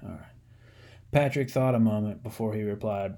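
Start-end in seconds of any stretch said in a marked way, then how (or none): 0.0-0.2 hushed promise it's nearly over; whispered aside. Real, not acted. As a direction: whisper it to the listener. Whispered reassurance to listener.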